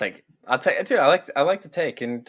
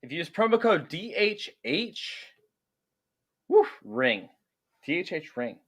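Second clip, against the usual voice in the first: slow, high, emphatic promo read; 7 semitones higher, slower than usual. Acted. Host reading the sponsor plug to listeners, slow ad voice.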